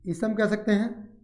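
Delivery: ordinary talk, nothing marked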